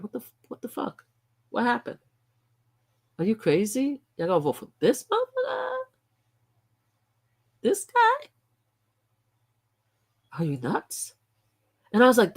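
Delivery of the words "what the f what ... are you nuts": higher, incredulous outburst at the voters; can't believe what they did, rising in pitch; stunned disbelief. Real, not acted. Vlogger reacting to the election result, incredulous.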